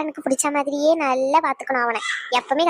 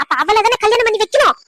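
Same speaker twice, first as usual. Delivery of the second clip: higher and louder, hammering the point; heated retort; 5 semitones higher, 4 dB louder than usual. Acted. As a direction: throw the argument back at her. (சூடாக திருப்பிச் சொல்லுதல்)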